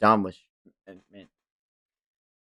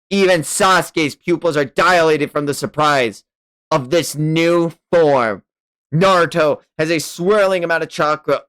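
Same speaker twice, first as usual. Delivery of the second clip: louder, higher hyped narration; excited narration; 7 semitones higher, 7 dB louder than usual. A narrator's reading. Reading the big transformation to viewers, hyped. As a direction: build the hype as the form appears.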